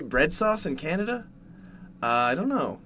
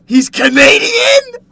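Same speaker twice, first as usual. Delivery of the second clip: very high, loud, slow shouted question; shocked outburst; 14 dB louder, much slower than usual. Acted. Streamer reacts to chat saying he's Canadian, loud shocked shout. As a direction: cry it out in mock disbelief.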